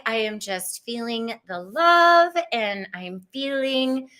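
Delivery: ordinary delivery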